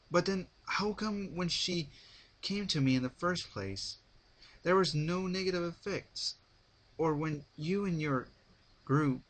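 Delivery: pushing back with doubts; pressing hero. A narrator's reading. Hero voiced, pressing questions.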